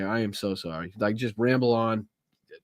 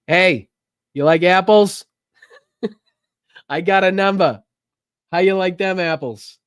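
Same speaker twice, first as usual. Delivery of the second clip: put-on loud, higher, cocky Boston accent; Boston movie quote; 7 semitones higher, 7 dB louder than usual. Host quoting Good Will Hunting, loud Boston taunt. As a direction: call it out in broad Boston. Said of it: "Goodwill Hunting clips in there".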